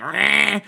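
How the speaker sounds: high, drawn-out mock "rah"; mock roar of helpless fury; imitated rage growl. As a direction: imitate a raging kid's growl. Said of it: "the rage where it's like".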